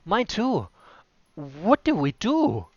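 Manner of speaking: high, slow, scared question; second orc asking his chief in panic; panicked orc. Acted. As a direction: sound helpless.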